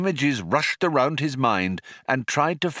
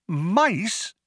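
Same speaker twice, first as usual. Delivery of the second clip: higher one-word query of disbelief; baffled echo; 10 semitones higher than usual. A narrator's reading. Visitor catching the only word that registered, baffled.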